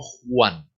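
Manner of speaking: "Juan" with a breathy Spanish J, hwan; "Juan" as the Spanish contrast example; Spanish jota. Shown as a demonstration. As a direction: show the throat friction opening "Juan". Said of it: "no se pronuncia como nuestra J".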